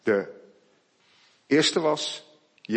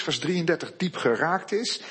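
normal talking voice

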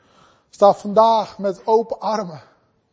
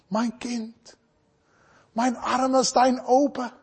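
God's sweet, loving voice. Acted. God calling his child back, sweet and tender. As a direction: gently coax a child home. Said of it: "met zo'n lieflijke stem".

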